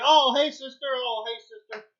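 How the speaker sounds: high, bright mock hello fading; cheerily hammed-up hello; mock greeting. Friend mocking random people greeting, high mock hello.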